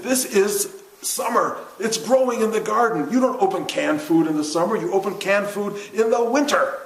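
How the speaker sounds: high, loud, emphatic lecture; mock scolding. Teacher lectures a student about summer garden food, mock scolding. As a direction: hammer it home like an exasperated teacher. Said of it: "He said".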